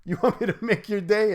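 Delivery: a movie-tough-guy dare, pitch lifted on want; daring the troll, playful menace; mock tough taunt, rising.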